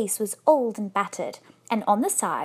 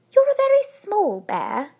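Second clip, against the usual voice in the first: high, louder storybook lady; voiced wife; 10 semitones higher, 5 dB louder than usual. A narrator's reading. Wife remarking on the bear's size, high-voiced. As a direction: talk brightly up at a higher pitch. Wife addresses the little bear.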